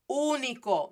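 "Único" as OO-nee-koh, leaning on OO; stressed first syllable. Shown as a demonstration. Teacher explaining the accent mark, stressed first syllable. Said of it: "with that accent, I just stress it more".

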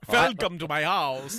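slow, loud put-on Transylvanian-ish accent; mock foreign accent. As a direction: ham up a vampire-host accent. Mock accent greeting after accents talk.

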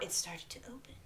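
hushed spooky whisper. Acted. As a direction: whisper it like a ghost story.

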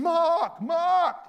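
high, loud calling of a name; imitating wife's shout. Retelling his wife calling him, loud and high. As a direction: call him like a wife across the house. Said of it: "Nita sometimes is shouting".

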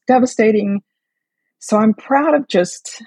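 trembling, holding back tears; shaky voice. Real, not acted. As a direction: voice shaking, fighting tears. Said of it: "sorry, my voice is shaking".

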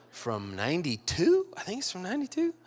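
usual speaking voice

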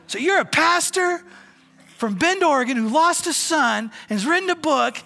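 put-on a stunned old man booming in disbelief; amazed loud exclamation. Bud's amazed loud summary.

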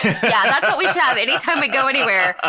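laughing while talking; chuckling through it. Real, not acted. Laughing along.

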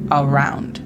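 "around" with stress on round, au diphthong; English around. Shown as a demonstration. Teacher models "around" for learners, English pronunciation. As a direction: say "around" stressing the second syllable. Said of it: "La pronunciación fonética de turn around sería".

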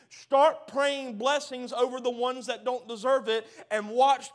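loud, rising sermon build; full-force preaching. Acted. Preacher rallying the church against sickness, full force. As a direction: preach it out to the crowd, hammering each line.

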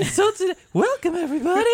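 high squeaky falsetto. Pretend. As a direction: play up the high voice. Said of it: "my voice got so high right there".

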